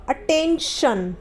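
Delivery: Attention drawn out slowly, each syllable clear; slow careful model. Shown as a demonstration. Teacher modelling attention slowly for students, careful and drawn out. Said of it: "आई का जो उच्चारण होगा वो अ होगा".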